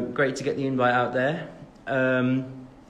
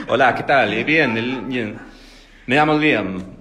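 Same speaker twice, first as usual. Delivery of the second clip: mock Spanish; 6 dB louder than usual. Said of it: "I just pretend to".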